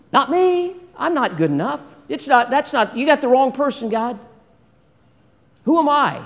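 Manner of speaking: high-pitched, incredulous objections; acted reluctant protest. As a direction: balk at the job as if wrongly picked. Voices a person refusing God's call.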